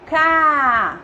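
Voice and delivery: "K" said as a long, held CA; stretched syllable. Shown as a demonstration. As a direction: draw out the vowel of "K" so it can be heard. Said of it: "Qual é a vogal da sílaba K?".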